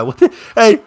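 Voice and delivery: normal talking voice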